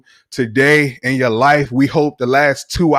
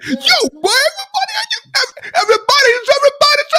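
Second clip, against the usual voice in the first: squeaky impression; faster than usual.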